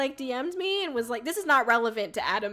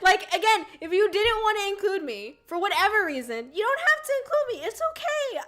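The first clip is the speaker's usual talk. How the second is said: high, strained, insisting it's fine; heated rant; 8 semitones higher than usual. Real, not acted. Venting to viewers about Adam, high and worked-up. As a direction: pitch up as the frustration spills.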